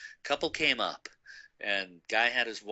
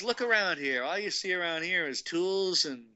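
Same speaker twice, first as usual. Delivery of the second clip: lightly imitated country dad, pitched up; a hint of country twang, higher voice; 8 semitones higher than usual. Dad explaining the flea market to his son, light country voice. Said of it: "in, like, some country voice".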